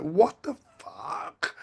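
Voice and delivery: slow, drawn-out disbelief; an exasperated what the fuck, said slowly; inner exasperation. Retold or imitated. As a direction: let the disbelief stretch out slowly. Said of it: "inside I was just like".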